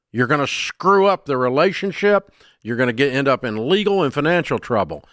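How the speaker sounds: normal talking voice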